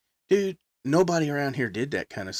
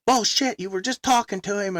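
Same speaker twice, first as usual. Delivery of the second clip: higher, indignant mock scolding; angry cussing; 10 semitones higher than usual. Mom cussing out the band on the phone, mock angry. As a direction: mom chewing them out, retold. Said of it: "starts cussing these guys".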